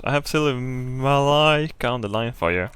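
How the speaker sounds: normal talking voice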